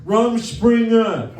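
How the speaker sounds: drawn-out high shout. Retold or imitated.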